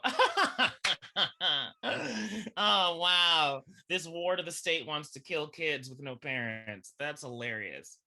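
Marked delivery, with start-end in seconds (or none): 0.0-6.9 loud, high laughing reaction; laugh, then marvel in a raised voice; mock amusement. Audience reacting to Miss Hannigan's song, loud mock-amused wonder. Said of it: "And we just go".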